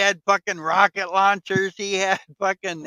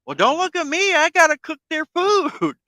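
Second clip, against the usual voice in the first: pitched way up, whiny excuse; high mock cook voice; 11 semitones higher than usual. Retelling the cook's excuse to his friend, high mock whine.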